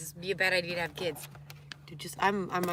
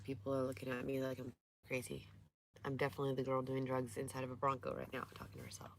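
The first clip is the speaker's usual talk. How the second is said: whispering; 4 semitones lower, 8 dB quieter than usual. Real, not acted. Aside while people stare.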